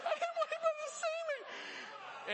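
very high, slow, laughing cries; hammed-up guy wailing through laughter; laughing mock yell. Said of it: "And he's yelling".

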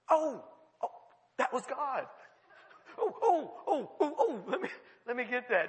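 high, stammered, eager oh-oh-oh; mock sudden realization. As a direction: act out a flustered scramble to respond. Acting out son's sudden realization.

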